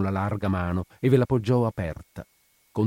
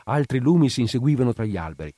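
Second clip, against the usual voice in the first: ordinary delivery, nothing marked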